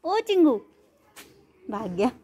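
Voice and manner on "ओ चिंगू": high-pitched call to a pet. Real, not acted. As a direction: call the dog over by name, pitched up. Calling the dog Chingu. (ऊँची आवाज़ में पुकारते हुए)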